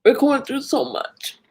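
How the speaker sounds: real tearful voice, pitched high; in tears. Real, not acted. Overwhelmed streamer grieving what they're going through, in tears. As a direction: choke back tears between phrases. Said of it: "I'm in tears thinking about it".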